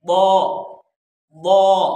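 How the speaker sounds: "बौ" as Arabic dad, loud and rounded; tongue's side on upper molars for heavy "बौ"; correct heavy dad. Shown as a demonstration. Teacher after explaining tongue placement, loud emphatic "बौ". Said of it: "फिर बौद, आ, आप अदा करना है".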